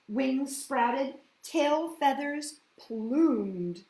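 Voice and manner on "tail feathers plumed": plain reading voice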